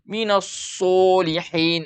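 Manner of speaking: "minas solihin" with SO rounded to "su"; wrong pouted so. Shown as a demonstration. Teacher reads the verse wrongly, pouted so. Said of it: "Ini salah".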